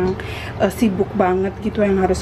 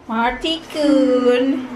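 sing-song call; 2 semitones higher, much slower than usual. Acted. Calling toddler sing-song.

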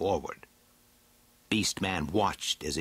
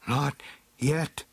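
higher, lightly raised warning; crying out; 5 semitones higher than usual. A narrator's reading. Beastman warning his companions of pursuit, crying out. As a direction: call a warning with raised pitch. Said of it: "cried Beastman".

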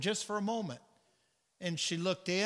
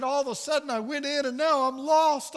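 high, louder, desperate retelling voice; distraught confession; 7 semitones higher, 5 dB louder than usual. Retold or imitated. Woman tells Brother Branham her story, distraught. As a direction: build to a distressed cry.

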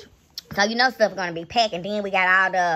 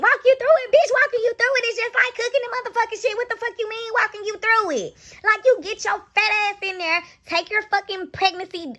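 she can't believe she's being asked; exasperated; 11 semitones higher than usual. Real, not acted. Scene: vlogger scolding the woman who can't cook, exasperated high outburst. Mocking request to be walked through cooking.

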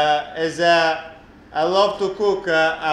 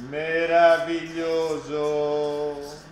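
drawn-out marvel; much slower than usual. Acted. Drawn-out exclamation over dough.

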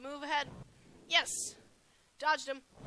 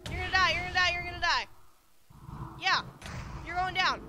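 raised, loud gamer trash talk; higher, fired-up battle chant; excited taunting; 4 semitones higher, 5 dB louder than usual. Real, not acted. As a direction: taunt your opponent mid-fight. Taunting opponent during fight.

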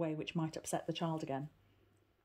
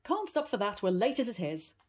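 quick, higher and louder retort; 4 semitones higher, 6 dB louder than usual. Acted. Aunt retorting to Heidi, impatient and loud. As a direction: brush her off impatiently. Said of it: "retorted Detty".

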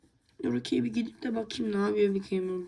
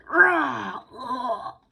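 loud snarl then yelp; loud growl and shout; dog growl; 4 semitones higher, 7 dB louder than usual. Acted. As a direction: snarl and cry out. Dog attacking in fight.

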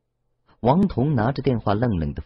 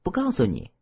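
playful refusal; 4 semitones higher than usual. A narrator's reading. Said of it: "鬼狐一甩长长的头发".

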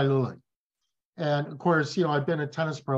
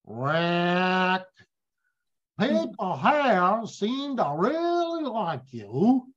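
slow, higher imitation of a thick Boston accent; a slow, higher stab at a thick Boston accent; attempted Boston accent; 5 semitones higher, slower than usual. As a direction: do the Boston boss in a self-admittedly weak Boston accent, slowly. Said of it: "I can't do a Boston accent very well".

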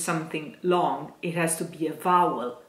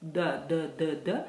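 ordinary delivery

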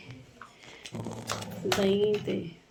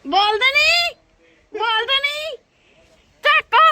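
high, loud panicked calls; frightened shout; 13 semitones higher, 8 dB louder, slower than usual. Real, not acted. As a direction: shout out scared. Frightened on high balcony, calling. (ਡਰ ਨਾਲ ਉੱਚੀ ਆਵਾਜ਼)

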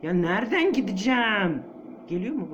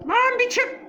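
tiz ve yüksek sesle bağır; çaresizce haykır; panikli bağırış; 5 dB louder than usual. Real, not acted. Panicking at the nun. (rahibeye panikle bağırış)

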